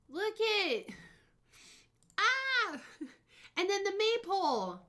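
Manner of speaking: high, loud delighted squeal breaking into a laugh; real giddy excitement, laughing; excited squeal. Real, not acted.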